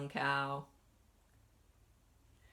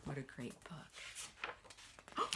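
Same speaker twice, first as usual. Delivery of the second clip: slow soft whisper; 3 semitones lower, 11 dB quieter, much slower than usual. Real, not acted. Teacher whispering to children after reading. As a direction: breathe it out quietly.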